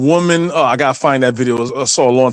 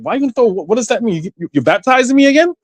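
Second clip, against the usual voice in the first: higher, faster incredulous protest; indignant disbelief; 8 semitones higher, faster than usual. Retold or imitated. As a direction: protest in mock shock.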